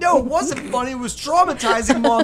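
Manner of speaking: mock indignant. Mock complaint to mother.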